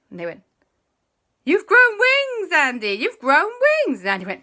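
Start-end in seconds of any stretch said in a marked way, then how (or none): 1.4-4.0 loud, high, drawn-out shout. Acted. The boys telling Andy he has wings, shouting. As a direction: yell the news at him.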